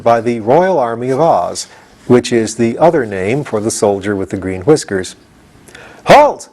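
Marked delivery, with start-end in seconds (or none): 6.1-6.5 high, loud halt; cried out. Acted. The soldier stops the leader, high and loud. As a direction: bark the order. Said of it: "he cried".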